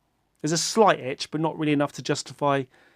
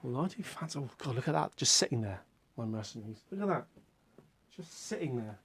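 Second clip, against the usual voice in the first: soft fond aside; 3 semitones lower, 11 dB quieter than usual. Real, not acted. Fond aside about his mercenaries.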